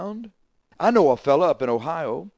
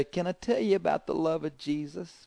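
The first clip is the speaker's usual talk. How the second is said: soft, higher, feeble-voiced appeal; 4 semitones higher, 7 dB quieter than usual. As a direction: imitate a frail man's weak, gentle voice. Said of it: "smile with his feeble voice".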